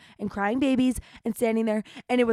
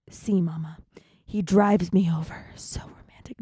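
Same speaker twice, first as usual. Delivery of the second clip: unvoiced whisper, teasing; 2 semitones lower than usual. Telling listeners about the ride, in a whisper. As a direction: breathe it like a secret joke.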